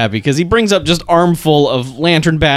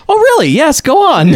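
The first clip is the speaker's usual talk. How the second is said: much higher, louder eager gushing; excited; 12 semitones higher, 4 dB louder than usual. Acted. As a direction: gush as if hooked on the story. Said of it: "The guy's getting really excited about this".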